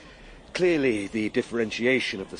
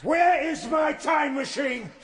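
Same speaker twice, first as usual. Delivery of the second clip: high, loud and drawn out, word by word; desperate rage at the uncomprehending Eloi; slow furious shout; 4 dB louder, slower than usual. Acted. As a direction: hammer each word at them. Traveller interrogates the Eloi.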